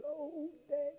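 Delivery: put-on high, pleading child's cry. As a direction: cry out like a hurt little girl. Hurt child crying to father.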